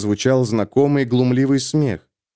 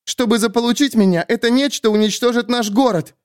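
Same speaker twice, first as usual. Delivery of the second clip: put-on higher, urgent young heroine; young woman, urgent; 10 semitones higher than usual. A narrator's reading. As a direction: press it, tense and scared. Sulin voiced higher.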